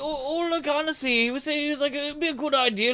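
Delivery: high, loud put-on Irish brogue; bad Irish accent. Freezing Irish explorer blaming his leader, in a hammed-up high Irish brogue. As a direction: ham up a clumsy Irish voice. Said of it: "butchering our fucking native accent".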